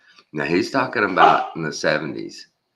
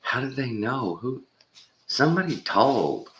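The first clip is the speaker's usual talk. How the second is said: high, whiny schoolkid voice; mock whining kid; 9 semitones higher than usual. Mocking the kid at school who always knows, high and whiny. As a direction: whine it like a nosy kid. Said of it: "it's always like".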